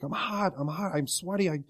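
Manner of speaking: high, soft mock discomfort; imitated distress. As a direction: act out waking up hot and uneasy. Imitates dad waking up in distress.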